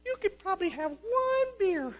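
the tempter in his head, sweet and slow; a high sly voice luring him to drink; tempting little voice. Retold or imitated. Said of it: "I hear the little voice".

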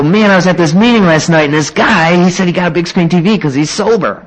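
high-pitched, hammed-up excitement; eager explaining. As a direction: gush it like a naive newcomer. Retold eager explanation to sponsor.

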